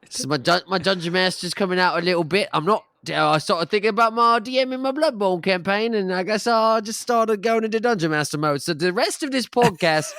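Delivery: high, hammed-up Cockney; Cockney accent. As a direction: ham up a Cockney dungeon master. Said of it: "I'll be doing in my Cockney accent".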